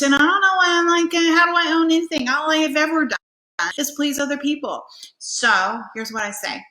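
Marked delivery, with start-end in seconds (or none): 0.0-4.8 high, loud, rushed protest; frustrated outburst. Acted. Acting someone who doesn't know herself, exasperated high outburst. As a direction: blurt it out louder and higher, exasperated.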